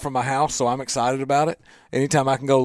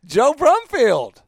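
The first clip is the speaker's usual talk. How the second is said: host intro; 8 semitones higher, 4 dB louder, much slower than usual. Real, not acted.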